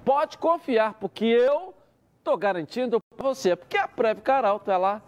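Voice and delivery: upbeat pitchy sales read; hard sell ad. Acted. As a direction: sell it like a radio spot. Host reads live sponsor ad. (locução de anúncio animada)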